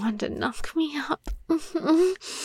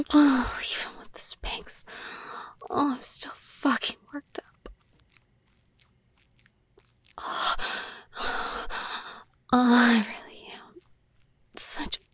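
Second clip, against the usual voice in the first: softer, whimpering hush; tearful whisper; 2 semitones lower, 6 dB quieter than usual. Acted. Worked up and frustrated before her partner, tearful whisper. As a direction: whimper it out under the breath. Strained, near tears.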